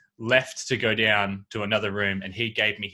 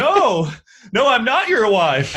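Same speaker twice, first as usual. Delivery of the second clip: much higher, louder and slower, mocking the man's alarmed denial; mock high protest; 11 semitones higher, 10 dB louder, slower than usual. Imitating the stranger in the hotel room denying he's his wife, much higher and louder. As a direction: squeak it out high and loud like a flustered stranger. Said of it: "And he's like".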